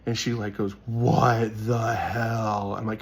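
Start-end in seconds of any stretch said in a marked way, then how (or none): 0.9-2.8 long, drawn-out disbelief; louder, stretch each word in mock outrage; imitated shocked protest. Retelling the girl with rods in her arm, exaggerated disbelief.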